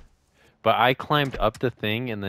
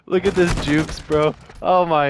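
excited; 6 semitones higher, 7 dB louder than usual. Real, not acted. Hyped over a juke play.

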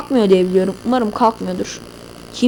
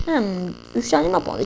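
ordinary delivery

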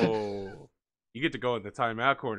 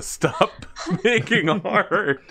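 laughing; 8 semitones higher, 8 dB louder, much slower than usual. Real, not acted. Joking about cursed commissions.

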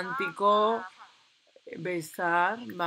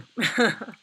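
laugh; 4 semitones higher, 9 dB louder than usual. Real, not acted. Gamer laughs at baby name.

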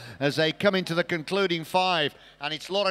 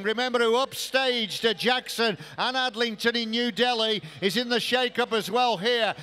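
normal talking voice

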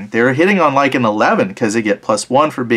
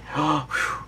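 sharp gasp; 4 semitones higher, 11 dB quieter than usual. Real, not acted. Tense die roll.

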